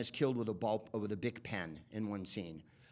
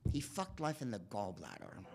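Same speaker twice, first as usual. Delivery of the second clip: softly, reverently, a touch hushed; gentle, understated tribute; quiet admiring echo; 6 dB quieter than usual. Retold or imitated. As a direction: savour the line like a fond memory. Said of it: "I'm like".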